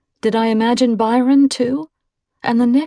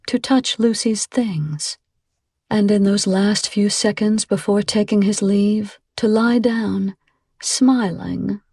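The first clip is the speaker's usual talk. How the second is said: usual speaking voice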